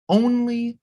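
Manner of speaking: only lifted high and stretched out; emphatic only. Real, not acted. Lecturer stressing that Martinu wrote only American symphonies, emphatic high word. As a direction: hit the word only hard.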